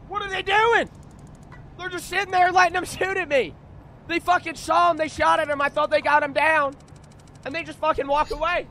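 high-pitched, very loud outraged rant; loud, high gamer rage; angry yelling. Real, not acted. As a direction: yell in outraged disbelief at useless teammates. Raging at teammates' failure in game.

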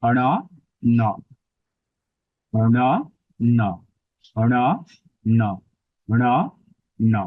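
separate, measured syllables, অণ retroflex and nasal; retroflex N demo. Shown as a demonstration. Teacher showing the Sanskrit retroflex N, syllable by syllable. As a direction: alternate অণ with tongue curled to the roof and ন. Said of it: "সংস্কৃতে মূর্ধন্য নয়ের উচ্চারণ স্থান মূর্ধাতেই হবে".

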